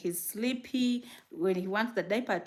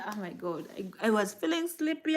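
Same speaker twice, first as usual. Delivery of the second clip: usual speaking voice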